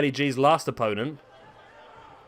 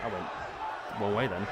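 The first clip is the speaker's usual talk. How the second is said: ordinary delivery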